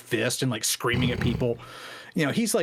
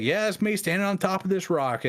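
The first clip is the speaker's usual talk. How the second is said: higher, called up to the house; calling back; 8 semitones higher than usual. Retold or imitated. Bo Greitz answers Randy from the rock, raised and higher. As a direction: shout it back up cheerfully.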